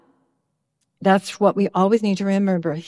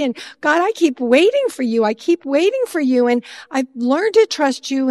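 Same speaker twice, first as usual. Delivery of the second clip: louder, higher, building frustrated plea; pleading angry complaint, getting louder; angry prayer; 4 semitones higher, 3 dB louder than usual. Retold or imitated. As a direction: vent at God, rising. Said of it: "and sometimes I say".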